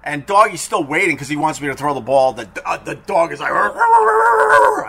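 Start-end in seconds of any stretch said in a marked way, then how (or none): 3.5-4.9 slow, much higher mock snarling growl; imitated dog growl. Teller imitating the snarling dog, mock growling noise. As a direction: growl like the aggressive leashed dog, hammed up. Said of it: "I need Jim Brewer's dog noise".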